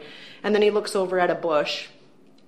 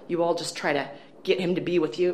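ordinary delivery

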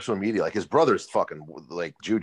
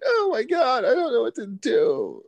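much higher, crying in panic; panicked whimper; 3 dB louder than usual. Retold or imitated. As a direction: whimper like a helpless coward. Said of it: "He's like".

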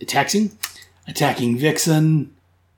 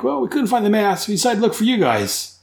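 lightly voiced comic villain; 8 semitones higher than usual. Punch answers Boomerang.

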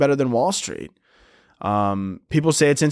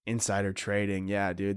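soft, muttered aside; quiet aside; 5 semitones lower, 10 dB quieter than usual. Real, not acted. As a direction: drop to a low aside.

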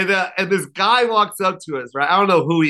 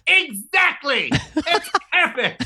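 Lovitz through laughter; 9 semitones higher, slower than usual. Retold or imitated. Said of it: "He goes".

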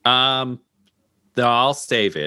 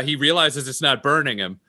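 normal talking voice

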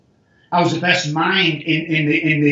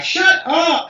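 high, sharp schoolgirl "Shut up!"; mock schoolgirl "Shut up!" said high; girl's catchphrase; 12 semitones higher than usual. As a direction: snap "Shut up!" like the girl who always says it. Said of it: "She used to always say it".

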